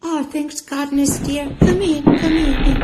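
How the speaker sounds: put-on high, loud relieved old lady; eager welcome; relieved granny. Granny inviting her visitor in.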